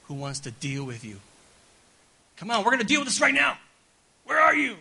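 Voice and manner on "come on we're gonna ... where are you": high, loud, fast furious shout; angry dad. Pretend. Preacher voicing an angry father calling a hiding child. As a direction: yell like a dad about to punish. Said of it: "We hear an angry dad".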